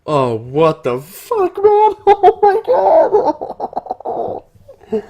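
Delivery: loud squeal shooting up very high; overwhelmed high-pitched shriek with laughter; real freak-out. Real, not acted. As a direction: drag it out, then break into laughing squeals. Reader freaks out at the story.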